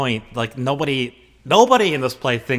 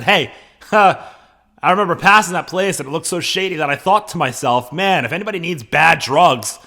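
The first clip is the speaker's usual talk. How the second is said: loud, high, laughing paraphrase; animated modern Romeo; 5 semitones higher, 6 dB louder than usual. Acted. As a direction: play it like a casual modern guy.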